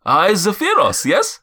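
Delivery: put-on high, loud accented giant; Zephyros. Giant copying the nose-tap introduction, high accented voice. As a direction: proudly copy the introduction, lifting high. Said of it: "he goes".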